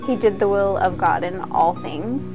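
ordinary talk

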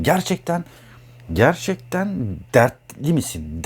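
gerçekten dragged out slowly and pressed hard; heavy slow emphasis. Shown as a demonstration. Asking a Cancer friend if they are really troubled, slow and heavy. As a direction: lean on gerçekten, slow and probing. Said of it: "Dertlenerek mi anlatıyorsun?".

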